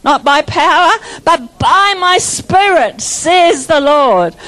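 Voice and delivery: slow, emphatic scripture declaration; preaching. Acted. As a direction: proclaim it slowly with full force.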